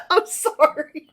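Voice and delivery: high, laughing apology; cracking up mid-apology; real helpless laughter. Real, not acted. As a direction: squeeze the words out through giggles. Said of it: "This chapter is making me laugh".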